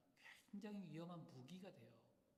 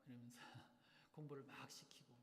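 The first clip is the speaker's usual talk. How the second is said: ordinary talk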